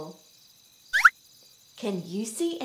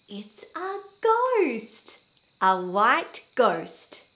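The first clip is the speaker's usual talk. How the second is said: ordinary talk, nothing marked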